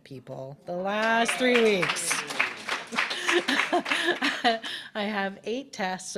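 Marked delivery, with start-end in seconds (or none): 1.3-2.1 "three weeks" thrown out much louder; punched three weeks. Real, not acted. Minister stressing her final school weeks, loud punch. Stressing how close she is. 2.2-4.9 loud open laugh; a hearty burst of laughter; real laugh. Real, not acted. Minister laughing about her last weeks, hearty laugh.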